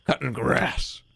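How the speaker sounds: lightly voiced middle-aged guy savoring garden plans; dreamy older self. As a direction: muse fondly about grass and tomatoes, half mocking. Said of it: "now I'm just sitting there thinking like".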